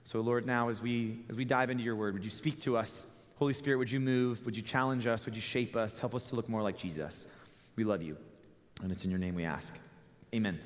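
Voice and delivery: low, soft prayer. Real, not acted. Closes an opening prayer.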